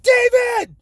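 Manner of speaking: high, loud shriek of the son's name; mock yell. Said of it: "She's like".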